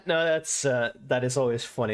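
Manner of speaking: usual speaking voice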